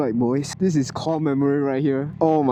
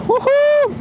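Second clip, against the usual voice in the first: loud, high woohoo; joyful whoop; 6 dB louder than usual. Real, not acted. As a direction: whoop with joy. Whooping while riding.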